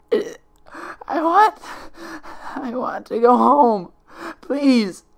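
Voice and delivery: high, loud, slow crying plea; sobbing stammer. Acted. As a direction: weep out the words, begging to go home. Twilight keeps sobbing, begging.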